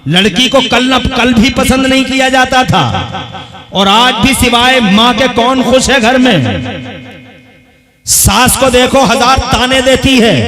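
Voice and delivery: loud, high, impassioned sermon delivery; fiery preaching. Acted. Preacher rousing the crowd about daughters, in a loud fiery sermon voice. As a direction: preach it hard to the congregation. Preacher rises to full force.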